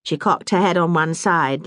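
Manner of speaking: ordinary delivery, nothing marked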